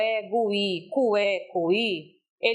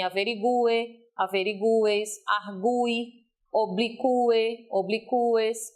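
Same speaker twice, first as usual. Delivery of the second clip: "averigue, averigues, argui, oblique, obliques" each with a strong separate U, as in a-ve-ri-GU-e; stressed U; slower than usual. Shown as a demonstration. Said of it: "tenho que ter cuidado com a pronúncia". ("averigue, averigues, argui, oblique, obliques" com o U tônico)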